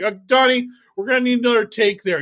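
a producer half-shouting across the set; nervous producer. Retold or imitated. Producer calling out to Donnie for another take, loud. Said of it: "a producer on a Trump video and being like".

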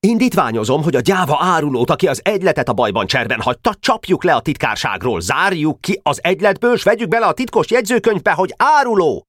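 high, agitated speech gathering height; excited motion. A narrator's reading. Richter moving to expel the traitor, excited and high. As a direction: propose it in a heated, high rush. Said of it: "Richter izgatottan jelentkezett szólásra".

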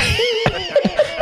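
squeaky high burst of laughter; high squealing laugh. Real, not acted. Laugh after feigned innocence.

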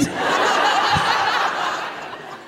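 breathy high laughter; airy high laugh. Real, not acted. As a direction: crack up. Laughs at the naked man.

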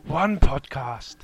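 slow, lightly voiced trailer announcer; retold mock trailer line; movie trailer voice. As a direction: repeat the tagline like a film trailer. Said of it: "that's what I was going for".

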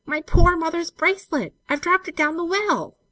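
loud, high-pitched wail; distressed cry. A narrator's reading. Olive telling Jem what fell, distressed. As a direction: wail over a precious loss. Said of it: "she cried in distress".

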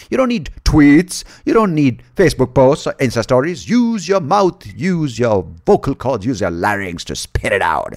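loud, high emphatic preaching; stretched, forceful exhortation; mock rant. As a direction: rail at the listeners, hitting each demand. Mock rant against euphemisms and tweets.

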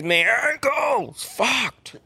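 high, slow hammed-up moan over a hurt ankle; mock pained wail. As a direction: drag it out whining in pain. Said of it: "He goes".

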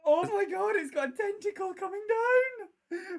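very high, loud horrified cry; shocked shriek. Real, not acted. Shocked at a tentacle in the jar.

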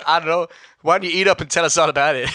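fast, loud, gleeful movie line; movie quote voice. Retold or imitated. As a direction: rattle it off like the film. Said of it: "I can't help but go".

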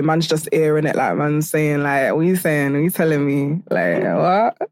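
low London roadman chat. Acted.

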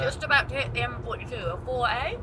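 talking round a sweet; mouth full. Real, not acted. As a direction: speak with a sweet in your mouth. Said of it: "I've just put a sweet in my mouth".

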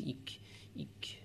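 whispered high squeaks; tiny chair squeal. A narrator's reading. Narrator voicing the swivel chair squealing, whispered squeaks. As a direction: squeak it out breathlessly like a chair spring. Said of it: "squeal tinily".

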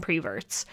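"preverts" starting with pree-; older folks' regional pronunciation. Retold or imitated. Recalling family back home, "preverts" said with pree-. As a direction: say "preverts" with pree- in place of per-. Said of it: "that's how they pronounce it".

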